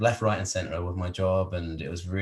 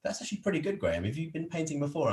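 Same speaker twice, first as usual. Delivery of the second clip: much higher, softer, mock amazement; impressed onlookers; 9 semitones higher, 6 dB quieter, faster than usual. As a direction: voice the impressed crowd lightly. Everyone impressed by his painting.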